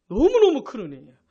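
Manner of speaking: "너무너무" punched loud; forceful emphasis. Real, not acted. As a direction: repeat it loudly to drive the point home. Louder repeat for emphasis. (큰 소리로 힘주어 강조)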